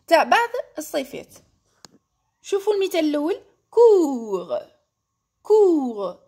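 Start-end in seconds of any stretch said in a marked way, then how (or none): 3.7-4.5 COURS drawn out, ending on the R with no T; silent final T. Shown as a demonstration. Teacher showing learners the silent letter, COURS without its T. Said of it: "ما رانيش ننطقها". 5.4-6.2 COURS held long, stopping on the R; silent final T. Shown as a demonstration. Teacher repeating the adjective for learners, COURS with silent T. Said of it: "ما رانيش ننطقها".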